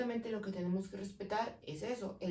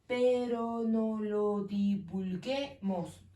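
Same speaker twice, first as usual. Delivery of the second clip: slow syllable count; much slower than usual. Shown as a demonstration. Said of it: "tenemos ocho".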